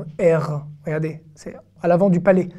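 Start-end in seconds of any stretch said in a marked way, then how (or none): 0.4-0.7 French R made at the front of the palate; R français devant. Shown as a demonstration. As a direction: say R further forward, in the French way. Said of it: "le R c'est très devant". (R français, très devant)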